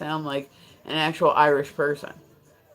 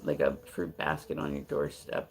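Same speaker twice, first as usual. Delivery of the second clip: low, quiet mock stalker; understated, low-key admirer impression; not-crazy stalker; 13 semitones lower, 5 dB quieter than usual. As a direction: lightly voice a mild-mannered stalker. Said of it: "He was just, like".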